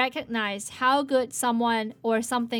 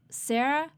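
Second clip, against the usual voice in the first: Sarah called as a tentative question. Acted. Calling after the imaginary friend, questioning. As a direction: call out Sarah, unsure, voice rising at the end.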